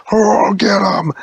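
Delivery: loud, high panicked cry; panicked shout. Acted. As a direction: yell it as they run. Siege panics in chase.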